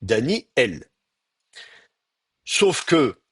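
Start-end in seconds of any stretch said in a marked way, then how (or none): 0.0-0.9 "Daniel" with a glottal break, Dani-El; glottal stop. Shown as a demonstration. Teacher illustrates the aleph, glottal stop in "Daniel". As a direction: stop the air after Dani, release on el in "Daniel". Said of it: "C'est un coup de glotte".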